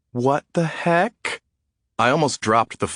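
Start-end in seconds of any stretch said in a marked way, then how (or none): 0.1-1.4 slow, high, incredulous "what the heck"; stunned disbelief. Acted. Narrator reacting to her friend's surprise news with stunned disbelief. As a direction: drag it out in pure shock.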